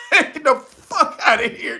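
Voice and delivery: normal talking voice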